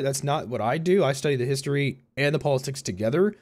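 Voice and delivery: ordinary talk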